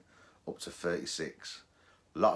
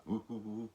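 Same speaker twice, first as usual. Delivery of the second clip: soft rising whoo tones; rising detector whoo; 4 semitones higher, 5 dB quieter than usual. Shown as a demonstration. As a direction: hoot like a detector beep. Imitating the good target tone.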